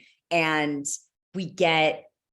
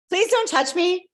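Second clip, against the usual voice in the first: pleading; 12 semitones higher, 5 dB louder than usual. Retold or imitated. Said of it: "I was like".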